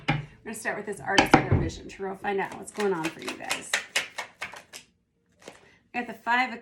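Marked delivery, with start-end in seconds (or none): 0.1-2.2 louder, faster, pushing past the hoarseness; louder retake. Real, not acted. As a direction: push the voice out, quick and loud. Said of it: "voice does not reflect that".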